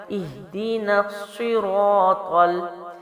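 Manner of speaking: slow recitation with الصراط, the ṭ said heavy and thick; drawn-out الصراط with full, emphatic ط. Shown as a demonstration. Teacher showing the student a heavy ط, slow demo. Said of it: "طا کو بھی جو ہے موٹا پڑھ کے".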